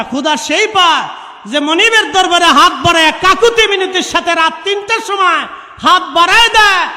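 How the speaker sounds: loud, high, driving sermon cry; full-force preaching. Real, not acted. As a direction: hammer it home to the crowd. (উচ্চস্বরে জোরালো ওয়াজ)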